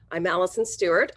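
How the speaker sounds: normal talking voice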